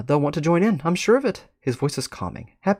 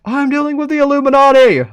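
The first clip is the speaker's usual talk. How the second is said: much higher and louder scream; scream back; 14 semitones higher, 10 dB louder than usual. Acted. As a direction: shout up the stairs indignantly. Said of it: "Term screams back".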